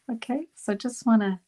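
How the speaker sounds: usual speaking voice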